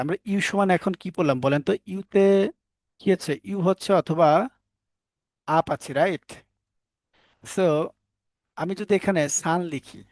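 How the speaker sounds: usual speaking voice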